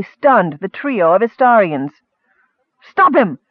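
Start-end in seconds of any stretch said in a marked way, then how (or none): 2.8-3.4 alarmed, surprised shout, pitched high; a startled officer yelling an order; cried out. A narrator's reading. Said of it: "cried the centurion".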